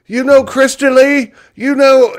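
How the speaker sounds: high, loud squeal of an excited fan; imitating an excited fan; excited hillbilly fan. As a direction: gush like a starstruck fan. Hillbilly fans' excited question.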